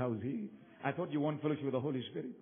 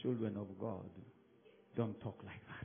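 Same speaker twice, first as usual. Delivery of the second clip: gentle Holy Spirit; 5 semitones lower, 5 dB quieter than usual. Retold or imitated.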